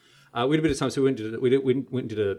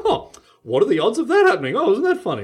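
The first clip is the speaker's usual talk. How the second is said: mock innocent tease; 11 semitones higher, 6 dB louder, much slower than usual. Mock innocence after stacking deck.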